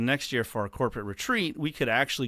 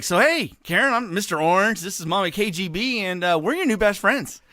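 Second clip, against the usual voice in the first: bright, much higher and louder, chummy greeting; cheery self-introduction; 8 semitones higher, 6 dB louder than usual. Retold or imitated. Retelling how he greeted the boat owner, higher and louder. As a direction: play the eager new buddy, high and bouncy. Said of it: "I was like".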